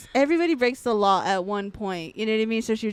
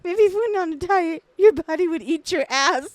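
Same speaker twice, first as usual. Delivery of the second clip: higher, hammed-up body insults; mock mean mom; 8 semitones higher, 5 dB louder than usual. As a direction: play the nasty mom, roasting her.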